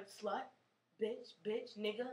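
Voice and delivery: hushed, low voicing of street insults; whispering lunatics. Lunatics whispering insults at her in the street, hushed. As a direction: drop to a soft near-whisper, word by word. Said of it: "full of lunatics whispering".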